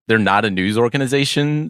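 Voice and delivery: ordinary delivery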